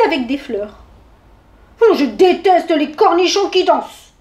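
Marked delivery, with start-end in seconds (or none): 1.8-4.1 loud, higher bear's outburst; roaring. A narrator's reading. Bear hating dancing pickles, roaring in protest. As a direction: roar it out with an opening huff. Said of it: "rugit l'ours".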